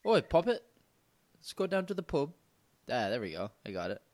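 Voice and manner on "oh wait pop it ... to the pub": high, hammed-up English pub accent; a bouncy, overdone British accent; mock English accent. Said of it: "we can do a little bit of role play".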